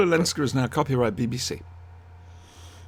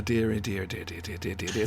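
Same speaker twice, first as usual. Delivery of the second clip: Irish accent; 5 semitones lower, 10 dB quieter than usual. Shown as a demonstration. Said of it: "Irish accent".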